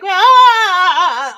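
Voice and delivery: loud high mock wail on ah; hammed-up shriek; mock hormonal scream. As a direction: let out a big fake frustrated ah. Imitating a constant hormonal scream.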